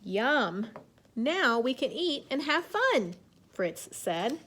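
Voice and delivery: slow, clear picture-book reading; reading to children. A narrator's reading. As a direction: read it gently to young kids. Teacher reads story aloud.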